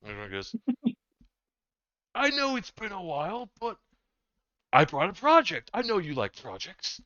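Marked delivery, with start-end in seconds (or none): 2.1-7.0 high, louder coaxing pitch; wheedling brother. Acted. Erdron coaxing his older brother into taking the project, high and eager. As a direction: sell it to the big brother, sweetly.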